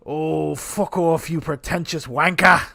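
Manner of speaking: put-on slow English accent, loud on wanker; drawn-out London put-down; little Ray Winston. A bad English accent aimed at Sasha. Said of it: "I'm not good. That was a little Ray Winston".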